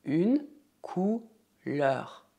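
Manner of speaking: drawn out slowly for the learner; slow repeat-after-me. Shown as a demonstration. Teacher modelling slowly for students to repeat. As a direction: stretch every sound so they can copy. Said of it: "Repita comigo".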